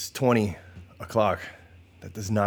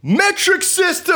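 very high, loud, stretched-out arena announcer shout; yelling announcer voice; 5 dB louder than usual. Pretend. Host alone in his apartment announcing the next point, yelling announcer voice. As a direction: belt it out like a hype announcer. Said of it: "this in my announcer voice".

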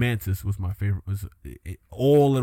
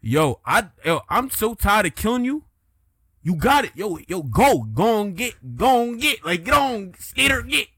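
high, loud, fed-up shooing; 9 semitones higher, 5 dB louder than usual. Acted.

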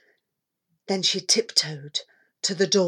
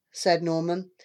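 usual speaking voice

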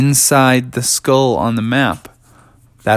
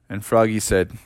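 plain reading voice

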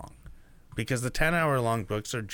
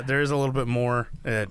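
ordinary talk, nothing marked

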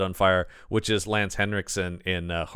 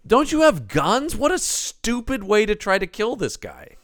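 incredulous outburst; 10 semitones higher than usual. Retold or imitated. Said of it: "And I'm going".

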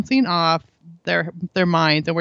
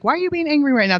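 higher, pointed why question; accusing question; 7 semitones higher, faster than usual. Shown as a demonstration. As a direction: demand an answer, accusingly. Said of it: "'Cause I think sometimes".

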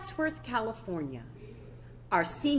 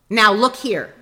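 heated reply; 2 semitones higher, 8 dB louder than usual. Retold or imitated. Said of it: "came the heated reply".

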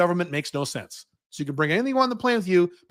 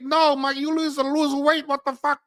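much higher mock Chinese accent, scolding; mock Chinese shopkeeper; 9 semitones higher than usual. Shopkeeper telling the tourist to lose weight, high mock Chinese accent.

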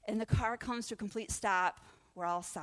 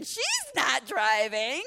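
screaming in protest; 6 semitones higher, 8 dB louder, much slower than usual. Retold or imitated. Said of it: "The girls in the back started screaming".

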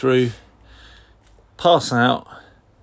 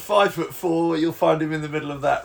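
mock parade announcer; 4 semitones higher than usual. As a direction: announce him like a TV commentator at Trooping. Mock parade announcement about Ward.

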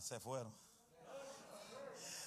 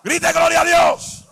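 full-force preaching; 4 semitones higher, 8 dB louder than usual. Acted. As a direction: drive it home to the church. Sermon climax.

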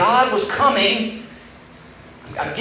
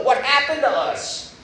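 longing plea; 7 semitones higher than usual. Retold or imitated. God mourning the lost friendship, slow and aching. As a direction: let the hurt slow it down. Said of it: "It's more the longing of his heart".

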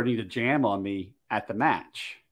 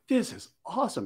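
high-pitched, lightly marveling; retold delight; 7 semitones higher, 4 dB quieter than usual. Shooter offered a cleaning lesson, retold with mild delight. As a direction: imitate your own pleased wonder, pitched up.

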